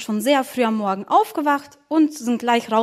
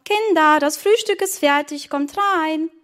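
mother's raised, higher-pitched call; 5 semitones higher than usual. Acted. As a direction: call the children in. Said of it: "rief die Mama". (laut nach den Kindern rufend)

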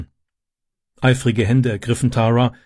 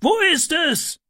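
very high called question; urgent calling. A narrator's reading. Man searching for the bed, urgent calling. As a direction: call the question out on a held high note.

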